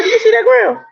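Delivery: high, loud disbelief at the icy ground; pointing out the obvious with alarm; incredulous. Retold or imitated. Trucker warns young trainee about the iced-over ground, loud incredulous.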